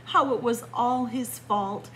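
ordinary delivery

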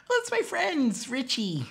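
high-pitched girl imitation; girlfriend voice. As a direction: pitch it way up as the daughter explaining. Girl answering her father.

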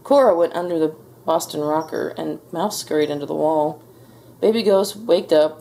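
ordinary delivery, nothing marked

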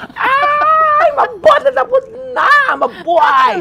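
very high, loud shriek of relief; shrieking with joy. Retold or imitated. Actor shrieking in relief after the scene, high and loud. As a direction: scream it out, giddy it's over. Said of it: "tumili ako talaga".

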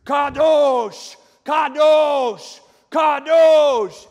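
high, loud Hebrew acclamation; angels' cry. Acted. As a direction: shout each one as a booming proclamation. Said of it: "In Hebrew, it's".

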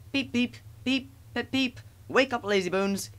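high-pitched cheeky little engine; whistling engine. A narrator's reading. Thomas startling a dozing big engine, high cheeky whistle. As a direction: pipe up high like a train whistle. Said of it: "he would whistle".

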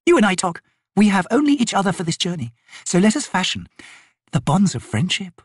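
high, cajoling noblewoman's voice; haughty lady, coaxing. Acted. Lady asking the cross soldier for friendship, coaxing. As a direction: coax prettily, in lofty, ornate phrases.

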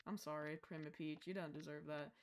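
low, soft murmured apology; quiet, subdued contrition; meek apology. Pretend. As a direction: drop right down and apologize. Sudden apology after cursing.

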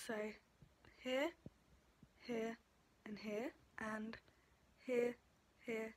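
talking with lip held open; distorted lip shape from finger; finger pulling lip down. Real, not acted. Seen 2: Finger pulls lower lip corner down, showing braces, throughout the words.

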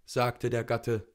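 ordinary delivery

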